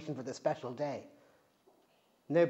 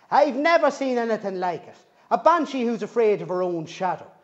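put-on louder, exasperated old granny; fussing granny; 5 semitones higher, 8 dB louder than usual. Granny frets at her hopeless grandchild. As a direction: tut and fuss like an Irish gran. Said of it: "she said as she fussed about".

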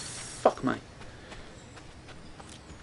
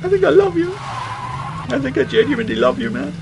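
hammed-up tearful love, pitched high; mock emotional; 12 semitones higher than usual. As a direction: overdo the emotion, like choked up. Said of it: "I'm getting emotional".

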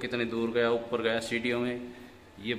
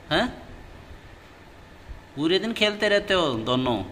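pet talk; 5 semitones higher than usual. Real, not acted. Talking to the pet parrots.

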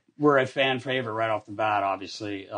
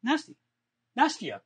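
high calling voice; 10 semitones higher, 4 dB quieter than usual. Retold or imitated. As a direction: call her name in a sweet high imitation. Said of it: "he goes".

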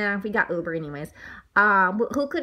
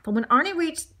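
usual speaking voice

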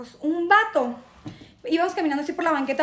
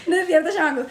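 normal talking voice

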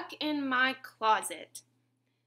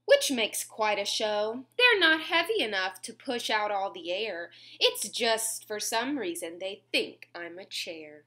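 put-on lilting read-aloud to kids; sing-song storybook reading; reading to children; 4 dB louder than usual. A narrator's reading. Reading a bedtime rhyme to little listeners, sing-song.

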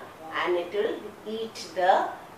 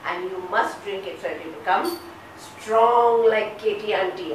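normal talking voice

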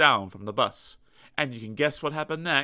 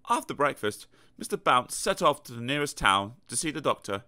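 plain reading voice

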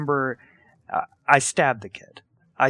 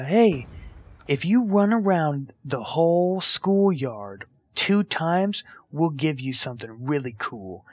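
sly bully offer; 2 semitones higher than usual. Retold or imitated. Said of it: "they're like".

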